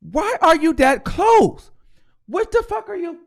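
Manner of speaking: incredulous outburst. Real, not acted. Yelling at Kenneth on video.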